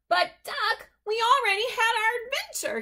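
high, loud, fast calling out; calling after. Acted. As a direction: chase him with the words. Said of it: "he called".

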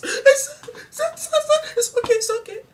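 very high, broken, tearful stammer; hammed-up crying while claiming it's fine; sobbing through it. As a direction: choke it out between sobs. Crying after the caning.

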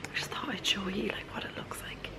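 hushed whisper so others sleep. Real, not acted. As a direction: whisper softly at night. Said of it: "I'm kind of whispering 'cause obviously people are asleep".

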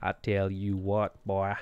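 slow, put-on southern US accent; lazily drawn-out southern accent, badly done. Streamer clowning for viewers, bad southern accent. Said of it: "That's the worst southern accent".

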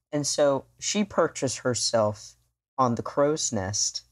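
usual speaking voice

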